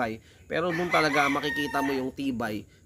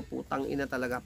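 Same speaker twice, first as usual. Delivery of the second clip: normal talking voice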